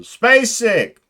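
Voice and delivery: hyped shout of the title; big announcement. Acted. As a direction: Space Sick shouted high and loud.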